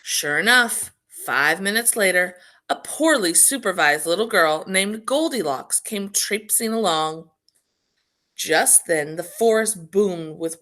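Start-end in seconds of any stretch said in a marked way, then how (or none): none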